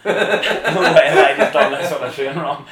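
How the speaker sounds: loud laughing no way, stressed high; laughing scream. Retold or imitated. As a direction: shriek it with joy, laughing. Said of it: "I was, like, screaming".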